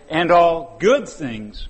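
And all good things, slow and lifted; stress on good. Shown as a demonstration. Preacher shows the misreading by stressing good. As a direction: read the wrong version, leaning on good. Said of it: "Notice it doesn't say".

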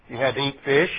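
usual speaking voice

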